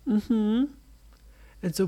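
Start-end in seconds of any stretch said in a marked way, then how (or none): 0.1-0.7 put-on timid little girl. A narrator's reading. Peggy agrees timidly.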